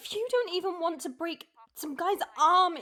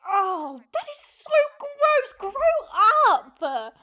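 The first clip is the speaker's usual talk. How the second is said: louder, higher grossed-out scolding; disgusted groan; 5 semitones higher, 11 dB louder, much slower than usual. Acted. Wife disgusted by her husband's joke, loud and high.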